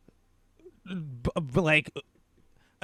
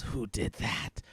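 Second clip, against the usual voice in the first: bear voice; 5 semitones lower, 9 dB quieter, slower than usual. Acted. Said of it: "it goes".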